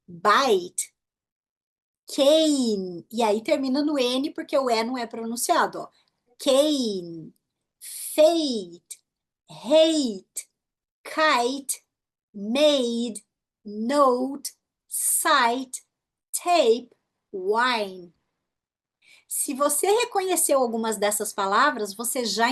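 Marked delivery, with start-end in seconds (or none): 0.0-3.1 drawn-out vowel named like its letter; long vowels. Shown as a demonstration. Teacher modelling word list, long vowels. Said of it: "pronunciadas pelo som longo da letra". 6.4-18.1 drawn-out vowel named like its letter; long vowels. Shown as a demonstration. Teacher modelling word list, long vowels. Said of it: "pronunciadas pelo som longo da letra".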